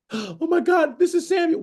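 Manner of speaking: high-pitched, hammed-up fright; mock scared. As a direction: pretend to be scared at seeing a spirit. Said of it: "Why would she be scared".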